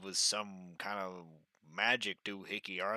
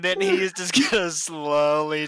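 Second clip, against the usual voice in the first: loud, high, breaking into laughter; laughing narration; 10 semitones higher, 21 dB louder than usual. Real, not acted. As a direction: narrate through a big laughing fit. Player laughs while narrating stunned reaction.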